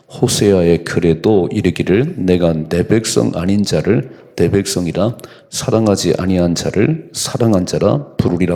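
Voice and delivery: loud, slow solemn Bible reading; scripture reading. Acted. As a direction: proclaim the verse to the congregation. Reads scripture aloud.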